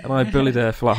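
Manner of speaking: normal talking voice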